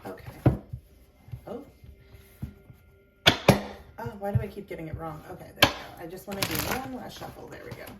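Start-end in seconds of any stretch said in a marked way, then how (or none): none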